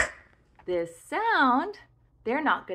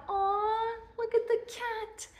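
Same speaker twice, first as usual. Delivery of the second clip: cooing; 11 semitones higher than usual. Real, not acted. Cute little cat on talker.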